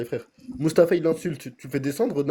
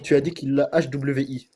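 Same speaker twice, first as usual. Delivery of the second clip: slow spelled-out accusation; much slower than usual. Real, not acted. Debater slowly repeating the opponent's term back to him. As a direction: spell out each letter slowly.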